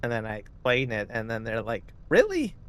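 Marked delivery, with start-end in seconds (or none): 2.1-2.5 high, incredulous "Really?"; astonished really. Retold or imitated. Friend hearing the explanation, shrieking disbelief. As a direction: pitch it way up in disbelief.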